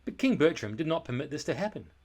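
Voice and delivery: ordinary delivery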